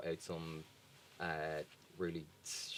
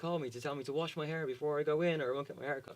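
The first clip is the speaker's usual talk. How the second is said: high whiny mock panic; hammed-up spoiled complainer; freaking out; 10 semitones higher, 5 dB louder than usual. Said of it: "you saw people freaking out over Twitter".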